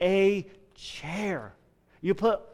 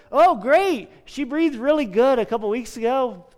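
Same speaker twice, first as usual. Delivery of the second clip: bright, higher, louder parent voice; cheerful parent; 6 semitones higher, 6 dB louder than usual. Pretend. Parent answering the pastor's question, higher, louder and cheerful. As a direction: play a chipper parent bragging. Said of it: "let's say the parent says".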